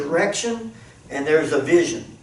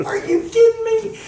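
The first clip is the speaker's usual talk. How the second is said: incredulous; 8 semitones higher, slower than usual. Retold or imitated. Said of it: "And I'm like".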